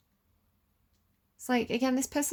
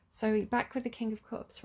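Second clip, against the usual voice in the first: usual speaking voice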